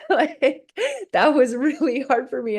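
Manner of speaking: real laugh, then words laughed through high. Real, not acted. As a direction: laugh it out, still giggling as you speak. Laughing over the quote.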